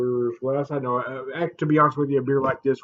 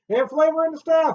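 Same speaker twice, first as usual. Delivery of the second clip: dramatic; 6 dB louder, faster than usual. Acted. Reading the label with flair.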